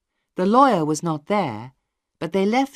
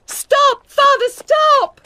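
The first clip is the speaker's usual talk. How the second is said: much higher, loud desperate scream, slow; 12 dB louder, much slower than usual. A narrator's reading. Maggie stopping her father beating Wakem, desperate scream. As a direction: scream at him to stop. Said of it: "she shouted".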